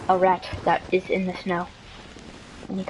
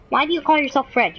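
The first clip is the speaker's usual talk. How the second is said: lightly voiced mom, pitched higher; mock scolding mom; 7 semitones higher, 3 dB louder than usual. Mom questioning her son's name, lightly acted high scolding. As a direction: question him in a raised, put-on mother's voice.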